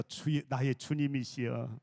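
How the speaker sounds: low, slow, reverent greeting; 공경하는 인사. Shown as a demonstration. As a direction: greet them slowly as your lord. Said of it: "우리 목사님을 향해서". (낮고 느리게 공경하는 인사)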